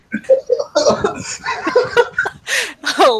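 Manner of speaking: high-pitched embarrassed giggle. Real, not acted. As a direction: burst into a flustered giggle. Player flustered by flirting.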